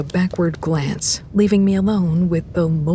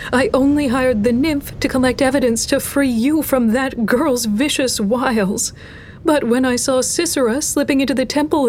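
higher-pitched, earnest priestess; desperate priestess; 6 semitones higher than usual. Acted. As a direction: swear innocence in a high, urgent voice. Priestess swears innocence to god.

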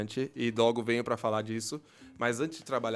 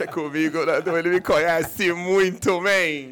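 loud; 4 semitones higher, 10 dB louder, slower than usual. Real, not acted. Joking boast.

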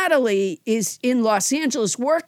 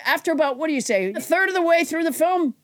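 usual speaking voice

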